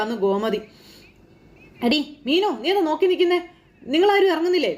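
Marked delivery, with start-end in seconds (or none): 1.9-4.7 calling out. Retold or imitated. Mother impatiently calls Meenu to leave, raised call.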